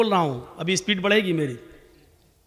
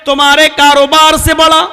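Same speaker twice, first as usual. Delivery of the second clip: loud, raised, ringing proclamation with held endings; full-force preaching; 9 semitones higher, 15 dB louder than usual. Acted. Preacher summons shopkeepers, farmers and office workers to prayer, full-force proclamation. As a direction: call out like a crier summoning a crowd. Preacher calls out to busy people.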